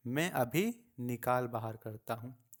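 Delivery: normal talking voice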